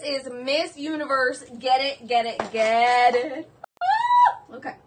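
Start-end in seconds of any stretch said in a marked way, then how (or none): 3.8-4.5 sudden high loud squeal; delighted squeal. Real, not acted. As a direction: let out a burst of glee.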